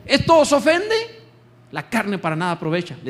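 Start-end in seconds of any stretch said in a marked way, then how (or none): 0.1-1.2 high, slow, loud challenge to the crowd; Jesus challenging. Acted. Preacher voicing Jesus challenging his followers, slow and loud. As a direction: voice Jesus asking if this offends them. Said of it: "Jesús le está diciendo".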